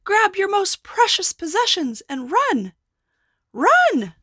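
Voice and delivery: high, loud panicked yell. Acted. Men yelling at the town to flee. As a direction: scream it at the fleeing crowd. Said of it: "Men yelled".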